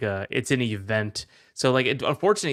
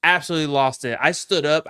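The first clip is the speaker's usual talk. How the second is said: normal talking voice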